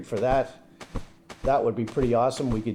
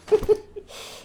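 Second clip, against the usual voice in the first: high airy laugh. Real, not acted. Laughs after teasing Speedy.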